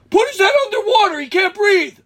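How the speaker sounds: fast, high, loud yelling; imitating a frantic friend; urgent instruction. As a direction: bark the rescue plan in mock panic.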